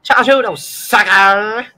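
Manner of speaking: loud gleeful taunt; excited. Real, not acted. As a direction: burst out with glee. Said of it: "I got a little excited".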